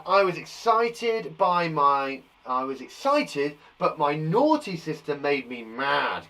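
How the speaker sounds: slow, careful read-aloud. Shown as a demonstration. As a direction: read it out slowly word by word. Reading child's sentence aloud.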